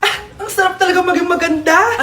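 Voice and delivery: ordinary delivery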